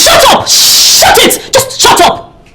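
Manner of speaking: sharp, high outburst easing back down; exasperated demand for silence; angry silencing. Acted. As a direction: cut them off furiously. Quarrel, silencing the man.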